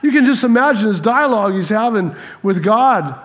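normal talking voice

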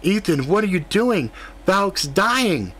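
high, panicked pleading; panicked plea. Acted. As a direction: beg him in panic. Panicked plea after stabbing.

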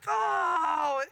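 a loud, high, let-down "Oh"; disappointed groan. Retold or imitated. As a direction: groan out the letdown. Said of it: "I was so disappointed".

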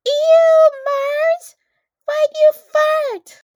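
very high, very loud mock-disgusted shriek; classmate's loud outcry. Classmate calling out Mars before everyone, mock shriek. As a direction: screech it slowly, imitating an appalled kid. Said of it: "And this guy goes".

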